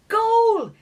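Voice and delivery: high, loud scream of triumph; screamed goal. A narrator's reading. Fairy player screaming as the ball scores, loud and high. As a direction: shout it with full joy. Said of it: "he screamed".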